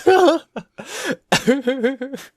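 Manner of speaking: loud high-pitched laughter; high giggling laugh. Real, not acted. Laughing at his own classroom story, high giggles. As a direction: crack up in a run of high giggles.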